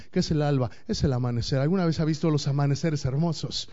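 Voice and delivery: ordinary talk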